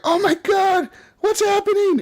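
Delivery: put-on high, squeaky little kid panicking over the Kraken; panicky kid voice. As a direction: squeal it like a scared young child. Panicking little kids in game.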